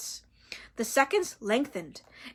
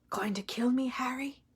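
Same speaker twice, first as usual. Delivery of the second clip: soft unvoiced whisper; hushed whisper; 7 dB quieter than usual. A narrator's reading. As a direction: breathe the question out.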